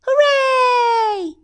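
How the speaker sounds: high, loud, long hooray; jubilant cheer. A narrator's reading. Crowd celebrates the king's rescue, cheering. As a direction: cheer it out at full pitch.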